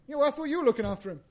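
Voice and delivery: the other parent's quick, indignant comeback; bickering parent firing back. Retold or imitated. Conversations between Mary and Joseph.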